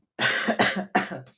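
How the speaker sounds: harsh throat-clearing cough. Real, not acted. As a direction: cough hard, throat sore. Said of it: "it is hurting my throat".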